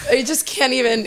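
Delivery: ordinary talk, nothing marked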